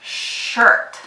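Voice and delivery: shirt opening on a long hushed sh; showing the sh in shirt. Shown as a demonstration. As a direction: lean on the sh at the start of shirt. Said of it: "repeat after me one more time".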